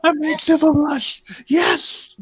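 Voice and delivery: high giggly delight; laughing squeal. Real, not acted.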